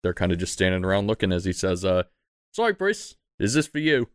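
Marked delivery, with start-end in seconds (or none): 2.5-4.1 high, light voice of a stable hand; stable man voice. Acted. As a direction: play a cheerful stable man. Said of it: "who's this guy speaking?".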